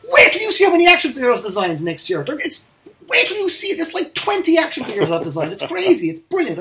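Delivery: high, loud, gushing enthusiasm; excited hype. Real, not acted. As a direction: build anticipation, bursting with excitement. Hyping his designs for next year.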